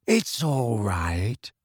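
very slow, floating dreamy voice; drawn-out, faraway girl. A narrator's reading. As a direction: reassure in a languid drift. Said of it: "said a dreamy voice".

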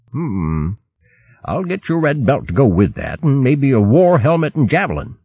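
lightly voiced old retainer; old servant voice. Servant speaks again.

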